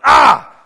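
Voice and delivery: sudden loud "Ah!" shout, high; startling yell. Retold or imitated. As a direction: pharmacist jumps out yelling "Ah!" to scare customer. Pharmacist startles customer with shove.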